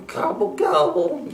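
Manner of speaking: mild grumbling gobble; grumbled gobble. Acted. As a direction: gobble under his breath grumbling. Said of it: "grumbled Turkey".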